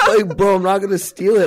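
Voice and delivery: high, loud laughing protest; laughing while protesting. Real, not acted. Laughing about woman clutching bag.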